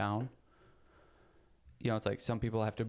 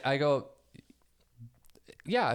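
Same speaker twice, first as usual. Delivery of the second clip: normal talking voice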